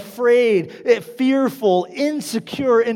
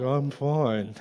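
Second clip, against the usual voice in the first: low, soft fake nonchalance; trying to be cool; 8 semitones lower, 8 dB quieter than usual. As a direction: brush it off with put-on calm. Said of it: "trying to be really cool".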